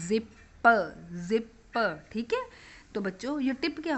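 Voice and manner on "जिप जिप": जिप split, final p released as "puh"; phonic sounding-out. Shown as a demonstration. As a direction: sound out जिप in two parts, zip-puh. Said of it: "last में पी की आवाज़".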